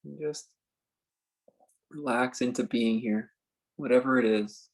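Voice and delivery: slow, spacious meditation guide with long pauses; calm phrases left hanging in silence; guided meditation. Acted. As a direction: ease them into stillness, unhurried.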